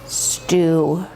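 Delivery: low wolf voice; a low, ominous one-word answer; menacing wolf. Acted. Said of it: "And the wolf says".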